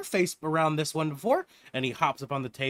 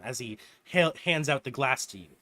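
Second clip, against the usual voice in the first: ordinary talk, nothing marked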